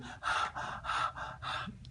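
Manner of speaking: soft breathy exhale of relief; long relief sigh. Real, not acted. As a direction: let all the fear out in one breath. Relief after baby breathes.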